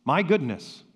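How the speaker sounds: normal talking voice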